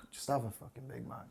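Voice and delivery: murmured, laid-back inner voice; calm tempting voice. Acted. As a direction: quietly coax yourself into giving in. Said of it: "the kind of calm voice that".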